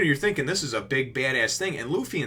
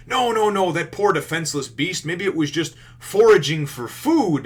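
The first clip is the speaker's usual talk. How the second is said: higher, louder pleading on the beast's behalf; mock protest; 6 semitones higher, 6 dB louder than usual. Sanji pleading for a hungry beast, hammed-up protest. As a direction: overplay the concerned objection.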